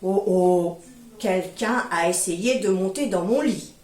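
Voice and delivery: low, loud booming bear; big bear voice. Acted. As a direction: boom it out like a giant bear. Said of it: "Et le grand ours dit".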